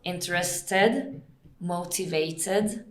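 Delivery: slow, distinct TED ending each word; stretched syllables with a clear final TED; pronounced TED ending. Shown as a demonstration. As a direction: sound out TED in interested and motivated. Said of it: "because there's no Ted or no dead".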